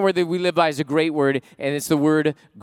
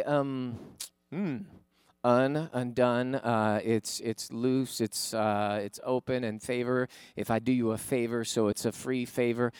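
quiet, hesitant mock congregant groping for words; a lightly voiced churchgoer stalling, um and uh; fumbling guess; 4 semitones lower, 6 dB quieter, slower than usual. Churchgoer put on the spot, hesitant and fumbling. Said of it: "You'd say".

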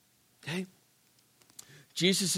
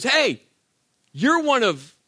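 acted accuser, louder and higher; raised-voice mock accusation; calling out; 5 semitones higher, 5 dB louder than usual. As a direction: call out to point someone out.

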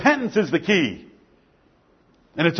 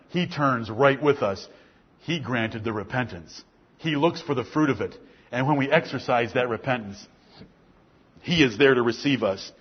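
tender preaching; 9 semitones lower, 8 dB quieter than usual. Acted. Soft, low tender preaching.

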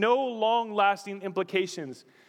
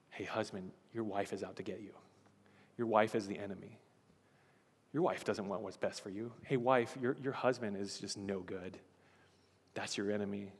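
low, hushed voice of a tempter; whispering lies; 7 semitones lower, 9 dB quieter than usual. Acted. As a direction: murmur poison softly into a spouse's ear. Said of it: "he's whispering lies in the ears".

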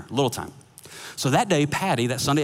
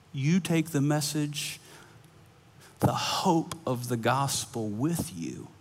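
earnest preaching; 5 semitones higher, slower than usual. Acted. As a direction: lean into the point and slow down. Preaching climax, slow.

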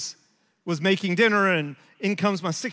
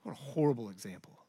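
much softer, low self-reproach; inner thought; 10 semitones lower, 11 dB quieter, faster than usual. Retold or imitated. Father realizing his failure, softly to himself. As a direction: say it to yourself in shame. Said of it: "just thinking to myself".

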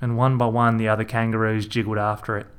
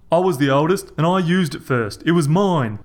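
higher, louder, stubborn; angry claim; 6 semitones higher, 5 dB louder than usual. A narrator's reading. Rog claiming the pole as eldest. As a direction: claim it hard, stressing mine.

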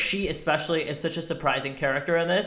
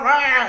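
loud growly rah; louder, hammed-up roar; mock roar; 8 semitones higher, 6 dB louder than usual. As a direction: roar like a blustering man. Mocking the men's outburst.

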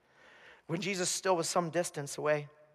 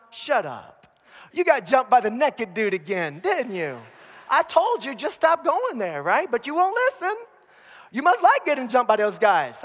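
rising, louder teasing rebuke, up to a high exasperated peak; mocking scold; 6 semitones higher, 6 dB louder than usual. Coworker chiding the beaten guard, louder and higher, mock exasperation. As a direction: needle him for getting jumped again, then throw up your hands.

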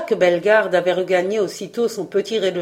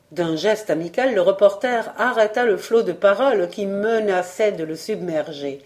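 plain reading voice

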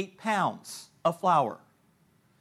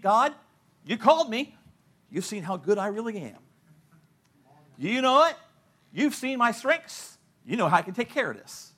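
higher, louder self-satisfied pleading; mock boastful prayer; 5 semitones higher, 4 dB louder than usual. Believer boasting to God, a touch of smugness. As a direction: brag to God, pleased with yourself. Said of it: "so often we think".

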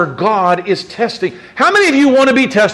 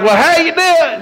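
high, bright all-American hello; cheery greeting; 7 semitones higher than usual. Retold or imitated. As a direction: play the eager smiling young guy. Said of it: "and he's like".

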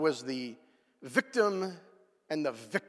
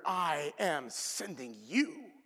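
slow solemn quote; 5 dB quieter, slower than usual. Acted. Quoting God's words slowly.

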